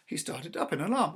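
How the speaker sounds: ordinary delivery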